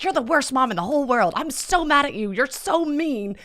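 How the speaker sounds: put-on high, loud child's tantrum; angry kid. Mom imitating her child yelling she's the worst mom. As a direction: shout it like a furious little kid. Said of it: "one of my kids was like".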